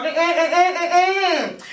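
high, insistent string of mm-mms; objecting hums. Retold or imitated. Girl on the phone denying she's from Virginia, high objecting hums. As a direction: hum a high emphatic no. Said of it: "She was like".

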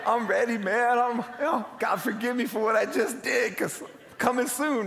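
high-pitched mock panic; hammed-up last prayer, voice lifted; retold freaked-out panic. Pastor thinking he'll die today, comically freaked out. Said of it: "like, freaked out".

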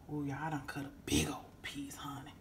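hushed whisper over the cake. Real, not acted. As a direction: whisper it like a secret. Whispering over the big slice.

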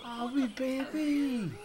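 put-on high, slow cooing baby voice; cooing baby talk. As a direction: coo at it like a newborn.

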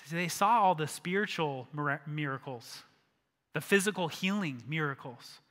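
ordinary delivery

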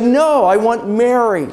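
high, insistent protest; anguished refusal. Acted. Joseph refuses the voice again.

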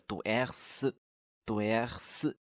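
doer drawn out very slowly, twice; slow model pronunciation. Shown as a demonstration. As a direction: stretch doer slowly for students. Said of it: "专门用一节课来讲了一个动词". (慢速示范读doer)

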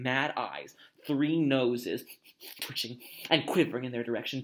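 plain reading voice

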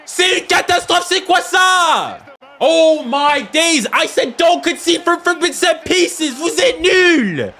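high, loud fan's tirade; real furious yelling, high and loud; outraged shouting. Real, not acted. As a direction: explode in exasperation at your team. Outraged at conceding a goal.

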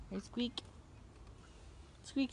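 ordinary talk, nothing marked

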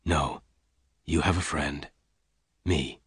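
low, soft reassurance; gently. A narrator's reading. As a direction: reassure her quietly and tenderly. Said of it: "Lassiter said gently".

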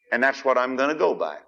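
ordinary talk, nothing marked

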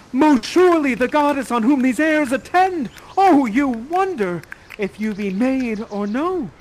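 high, loud, lovestruck declamation; crying out. Acted. Ferdinand to the most beautiful woman, crying out in wonder. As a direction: cry it out in theatrical wonder. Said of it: "he cries out".

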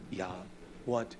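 usual speaking voice

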